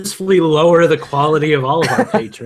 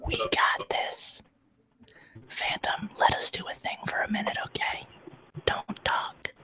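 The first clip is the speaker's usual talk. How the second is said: hushed whisper; 5 semitones higher, 15 dB quieter than usual. Real, not acted. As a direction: whisper so the mic barely catches it. Whispering while mic is live.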